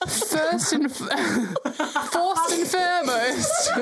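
giggling through the words; stumbling over the phrase, laughing. Real, not acted. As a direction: fight the laughter.

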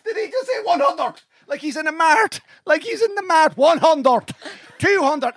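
high, laughing retelling. Real, not acted. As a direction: laugh through the words. Laughing, recalling the line.

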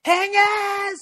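high-pitched drawn-out call; high, stretched-out mock shout; imitated heckle. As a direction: yell it like a stranger hailing someone. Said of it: "like that".

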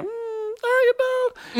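high, soft, drawn-out sarcastic doubt; a falsetto side-eye question; sassy doubt. Retold or imitated. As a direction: skeptical falsetto "are you, though?". Sceptical reply.

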